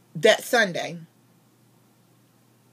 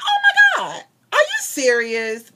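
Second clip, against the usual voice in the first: stunned disbelief; 9 dB louder than usual. Retold or imitated. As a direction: squeal it, then stretch out the question, re-enacting shock. Acts out stunned reply to pastor.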